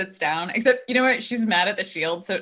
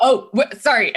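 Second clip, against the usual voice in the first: louder, higher flustered start; startled apology; 3 semitones higher, 8 dB louder than usual. Acted. Bryn turning around to a voice, startled apology. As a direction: blurt it as if caught off guard.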